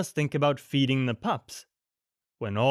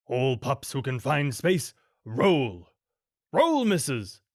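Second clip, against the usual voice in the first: barked command; slower than usual. A narrator's reading. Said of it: "Pongo barked a command".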